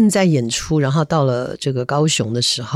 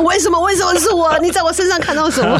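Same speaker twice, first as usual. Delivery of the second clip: mock panic; 13 semitones higher than usual. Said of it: "如果我是他".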